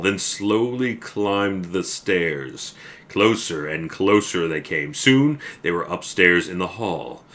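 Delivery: building suspense, slowing at the stairs; slow each step as it climbs; story narration. A narrator's reading. Reads the story aloud.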